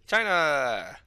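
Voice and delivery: calling out. Acted. Friend calling out to injured China, loud. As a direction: call out with a long falling tail.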